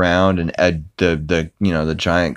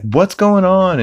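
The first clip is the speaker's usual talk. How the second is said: alarmed Lois; 12 semitones higher, 3 dB louder, slower than usual. Retold or imitated. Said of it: "Lois is like".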